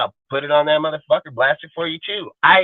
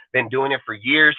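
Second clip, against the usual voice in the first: ordinary delivery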